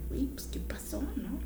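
soft mock-innocent whisper; whispered meek reply. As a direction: whisper it, sheepish and small. Imitating the partner's meek reply.

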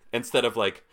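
ordinary talk, nothing marked

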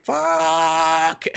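high, loud, drawn-out curse; mock anguished yell. Heroine finding her sword stuck in the sheath, mock anguished yell. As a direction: stretch it into a wailing cry. Retold cry at frozen sword.

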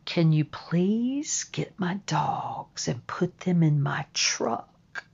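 hushed, careful request; whispering. Retold or imitated. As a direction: whisper it so the dog stays calm. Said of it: "I started whispering to the psychotherapist".

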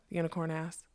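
plain reading voice

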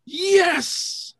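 a very high triumphant "Yes"; cheering. Retold or imitated. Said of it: "now I'm cheering".

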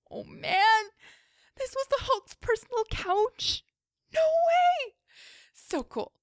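high, breathless fangirl glee; giddy excitement. Acted. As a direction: gush with delight. Fangirling over Hulk couch.

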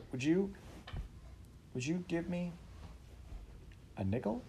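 high, timid, hesitant ask; timid boy. A narrator's reading. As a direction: ask nervously like a scared boy. Scared boy asking father.

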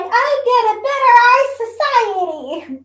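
high, loud, rushed mock complainer; mock Karen. Podcaster mocking one Karen to the co-host, high, loud Karen voice. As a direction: do an exaggerated Karen outburst. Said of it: "one Karen that's like".

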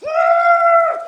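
piercing, sustained high shriek; re-enacted scream. Preacher reliving bursting through the church door, re-enacted scream. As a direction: scream high and loud, holding one long note. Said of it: "I just screamed".

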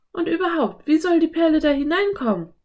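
put-on high, quick girl character voice; Anne. A narrator's reading. Anne doubts a pearl could get inside, high quick voice. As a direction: question it fast and high.

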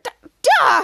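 high, loud burst; exasperated outburst. Retold or imitated. Exasperated cry after cops hang up.

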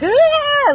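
put-on high, loud toddler shriek; imitated toddler yell. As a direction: mimic an aggravated little boy yelling. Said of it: "he'll yell at her".

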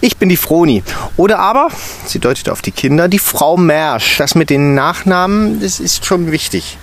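correcting first name to surname; stern teacher. A narrator's reading. Stern teacher insisting on her surname.

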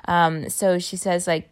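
normal talking voice

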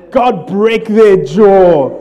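slow, loud, hammed-up prayer for revenge; mock vengeful prayer. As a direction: drag out the curse like a wrathful psalmist. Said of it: "prayers out of Psalms".

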